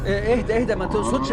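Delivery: ordinary talk, nothing marked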